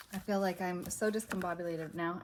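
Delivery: usual speaking voice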